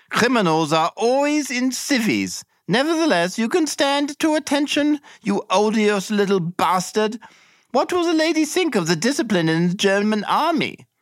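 sneering drill-officer contempt in a high voice; Captain Blood bellowing. Acted. Captain Blood berating the narrator before the lady, high sneering voice. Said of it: "bellowed at me".

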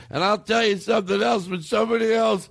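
high, insistent mock rant; complaining uncle. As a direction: imitate a drunk uncle griping at nobody. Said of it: "hear him complaining".

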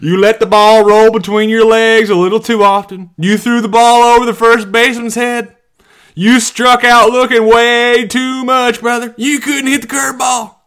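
high, loud heckle with stretched words; mocking taunt. Comedian listing a high school player's failures, mocking heckle.

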